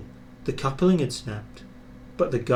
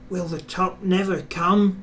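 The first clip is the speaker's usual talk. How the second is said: weary straining; 6 semitones higher than usual. A narrator's reading. Straining up the hill.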